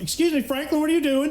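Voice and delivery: high, prim teacher rebuke; a teacher scolding a student mid-class; stern teacher. Retold or imitated. Teacher scolding Franklin in a high voice.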